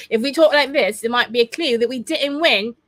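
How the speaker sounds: mock British accent. Shown as a demonstration. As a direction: slip into a jokey British accent to make the point. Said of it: "We don't all talk like this".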